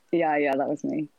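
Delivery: ordinary delivery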